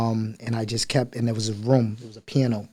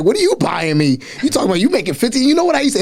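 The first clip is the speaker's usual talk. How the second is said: mock-indignant mom, high and rapid; mother's voice; 10 semitones higher, 3 dB louder, faster than usual. Mom throwing the complaint back at her child, indignant. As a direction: imitate mom firing back in a raised pitch. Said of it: "she's like".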